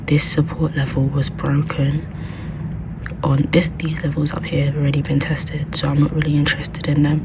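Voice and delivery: hushed library whisper. Real, not acted. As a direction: whisper so the library can't hear. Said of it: "I have to whisper because obviously I'm in the library".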